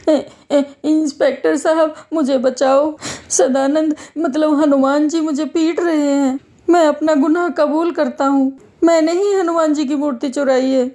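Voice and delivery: high, panicky confession; frightened pleading. Acted. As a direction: cry to the inspector for rescue. Fleeing thief begs police for help.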